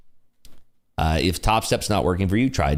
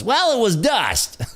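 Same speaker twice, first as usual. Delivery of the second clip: gleeful punchline; 13 semitones higher, slower than usual. Real, not acted. Punchline of the story.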